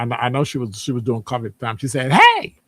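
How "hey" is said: sudden high, loud call across the bar; holler. Retold or imitated. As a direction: holler to get a coworker's attention. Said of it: "she hollers".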